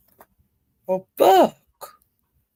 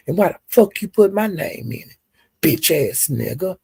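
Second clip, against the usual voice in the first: ordinary talk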